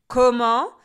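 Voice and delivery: very loud indignant yell; outraged shout. Acted. Witch hearing insults, loud outraged shout. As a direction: bellow in outrage.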